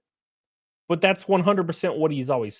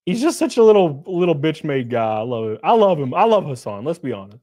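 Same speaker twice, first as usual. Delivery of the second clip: ordinary talk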